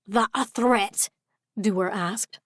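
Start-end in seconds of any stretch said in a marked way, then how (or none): 0.1-1.1 put-on slow challenge in a Scottish accent; Scottish pixie squaring up. A narrator's reading. Pixie challenging the intruder, Scottish accent. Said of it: "sounded like a Scottish accent".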